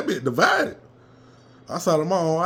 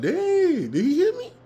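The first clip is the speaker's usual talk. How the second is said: much higher, startled exclaim; excited surprise; slower than usual. Retold or imitated. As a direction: gasp it out in disbelief. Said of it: "I was like".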